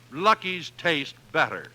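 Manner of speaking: ordinary talk, nothing marked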